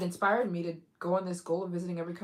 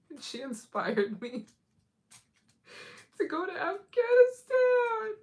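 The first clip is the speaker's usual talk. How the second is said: giggling through it, pitched up; laughing reply; 11 semitones higher, 7 dB louder than usual. Retold or imitated. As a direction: laugh while confessing the reason. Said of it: "You'd be like".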